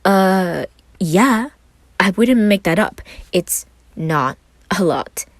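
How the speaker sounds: playful mock echo; mocking imitation. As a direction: mimic him with air quotes. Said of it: "put his hands up in air quotes".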